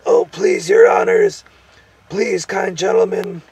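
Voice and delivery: high, loud pleading whine; whimpering gnome. A narrator's reading. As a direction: beg pitifully in a high creature voice. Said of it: "whimpered the gnome".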